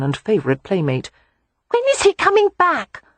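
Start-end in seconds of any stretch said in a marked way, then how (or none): 1.7-3.0 put-on high, eager child's voice; a little boy asking when his cousin returns; high pleading child voice; small boy. A narrator's reading.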